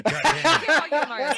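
drawn out with laughter; laughing through a drawn-out curse; laughing mock curse. Real, not acted.

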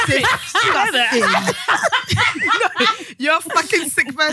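high, laughing mock disgust; laughing outrage. Real, not acted. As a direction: scold her while cracking up.

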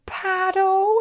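high, held-out shout; urgent call. Retold or imitated. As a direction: call back over the shoulder. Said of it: "looking over his shoulder and just going".

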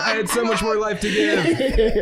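high, slow, mock tragic wail; melodramatic lament. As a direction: lament like a dying hero, laughing.

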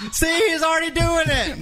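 very high, squealing through laughter; laughing. Real, not acted. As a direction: shriek it while laughing hard. Laughing at the noises.

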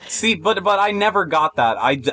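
ordinary delivery

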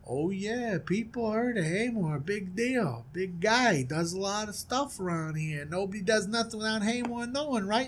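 understated wary small talk; unimpressed newcomer. Acted. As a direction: play the stranger humoring a big shot. Plays the newcomer replying.

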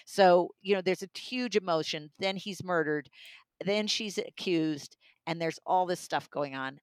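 usual speaking voice

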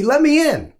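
mimicking a driver yelling from his car; demanding yell. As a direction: call it out as an insistent demand. Retold driver demanding entry.

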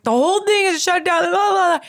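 high, strained, tearful complaining voice; whiny crying rant. Retold or imitated. Stuck in traffic, wailing to her husband, high and tearful. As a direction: squeak it out like you're sobbing behind the wheel. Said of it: "I'm literally, like, crying in the car".

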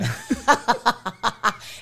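high, breathy burst of laughter; real giggle fit. Real, not acted. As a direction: crack up at the memory. Laughing at the pizza memory.

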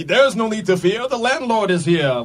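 mock heroic landlord; lightly voiced heroic proclamation; hero announcer voice. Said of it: "I'm like".

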